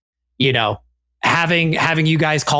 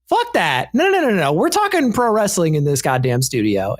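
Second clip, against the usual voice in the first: high-pitched burst, settling toward the end; re-enacted eager outburst, starting way up high; fired-up insistence; 6 semitones higher than usual. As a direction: cut everyone off with excited insistence. Retells his own eager outburst.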